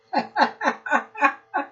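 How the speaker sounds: high mock laugh of the rat; storyteller laughing as the rat; rat's cackle. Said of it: "laughed the rat".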